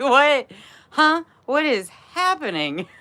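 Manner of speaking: high-pitched laughing what; laughing shock. Retold or imitated. Retelling her stunned reaction through laughter.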